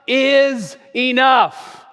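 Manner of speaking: loud, slow, drawn-out declaration; defiant shout. Retold or imitated. Preacher voicing Rosa Parks refusing, loud and defiant. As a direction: shout it like she's had enough.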